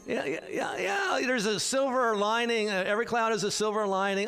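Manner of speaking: high-pitched parody of empty cheering-up; mock pious platitude. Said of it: "what we call pious platitudes".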